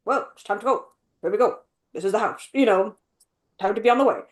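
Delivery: understated, uninvolved line reading; just saying lines. Retold or imitated. Imitating the British beaver, just saying his lines. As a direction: imitate an actor merely reciting. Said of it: "he's just saying his lines".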